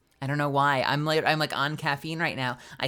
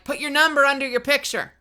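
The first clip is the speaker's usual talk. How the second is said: bossy request; 8 semitones higher, 6 dB louder than usual. Retold or imitated. Handing yearbook to classmates.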